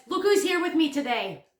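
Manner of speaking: usual speaking voice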